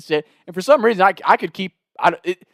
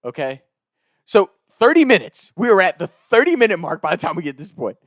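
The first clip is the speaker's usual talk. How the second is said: ordinary talk, nothing marked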